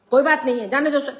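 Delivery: loud, high insistence; forceful dismissal. A narrator's reading. Father telling the aunt to let him go, forceful. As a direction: brush the objection aside forcefully. Father brushes aunt's protest aside.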